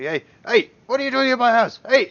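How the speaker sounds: high, loud alarmed yelling; angry householder shouting from down the road; shouting homeowner. Acted. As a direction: call out at intruders in your house. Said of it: "a voice sort of say".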